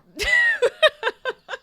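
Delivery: loud, high-pitched burst of laughter; shrieking laugh of frustration; exasperated laugh. Real, not acted. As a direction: laugh it out instead of screaming. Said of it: "I wanna scream".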